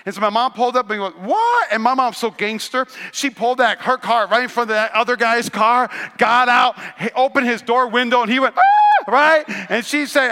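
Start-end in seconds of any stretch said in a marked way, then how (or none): 1.2-1.7 sudden high startled "What?"; shocked what. Retold or imitated. Mom shocked, pulls up. 8.6-9.1 high loud startled yelp; a man jumping in fright, yelping. Retold or imitated.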